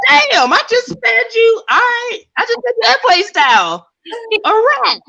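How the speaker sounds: squealing whine. Retold or imitated. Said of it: "I'm like".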